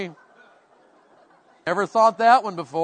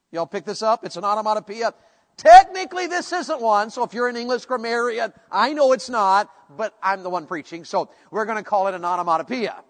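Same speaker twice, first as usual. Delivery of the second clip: ordinary talk, nothing marked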